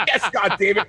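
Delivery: high, loud mock argument; hammed-up indignant yelling; exasperated outburst. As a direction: act out an incredulous, angry retort. Acted angry argument over Walt.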